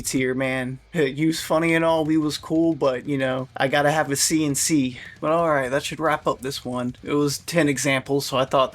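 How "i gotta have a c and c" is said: ordinary delivery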